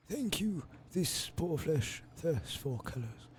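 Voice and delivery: put-on high, quavering old man. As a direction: croak it like a frail, dying elder. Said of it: "give him an old man voice".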